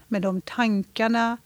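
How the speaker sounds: normal talking voice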